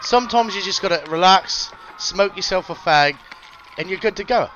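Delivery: mock English accent. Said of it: "your English accent".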